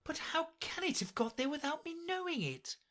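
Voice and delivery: high, soft wondering voice; puzzled Alice. A narrator's reading. Alice wondering to herself about the crown, high and soft. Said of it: "she said to herself".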